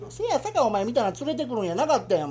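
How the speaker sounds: normal talking voice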